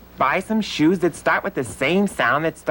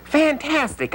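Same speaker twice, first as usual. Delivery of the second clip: high, thrilled fantastic; delighted; 10 semitones higher than usual. Acted. Puppet thrilled to see feather shoes, delighted. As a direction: burst with joy at the feathers.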